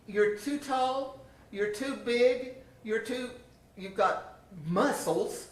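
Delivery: high, slow listing of faults; slow mock tester. Tester lists the tall girl's faults in a slow, high mock voice. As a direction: drag out each flaw like a scornful examiner.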